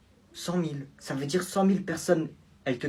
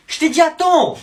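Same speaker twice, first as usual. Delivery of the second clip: angry snap; 11 semitones higher, 10 dB louder than usual. Acted. Snapping at his mother.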